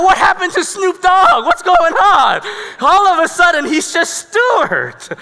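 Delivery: high, loud mock disbelief; incredulous outburst. Onlooker amazed at the tough guy's transformation, incredulous. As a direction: exclaim it in hammed-up bewilderment.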